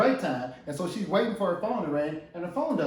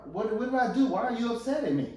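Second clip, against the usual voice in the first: bewildered husband; 6 semitones higher than usual. Acted. Husband questioning wife in acted quarrel.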